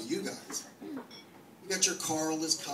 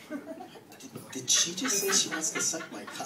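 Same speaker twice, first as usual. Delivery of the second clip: mock shock; 6 semitones higher, slower than usual. Shocked reaction to sockerkaka.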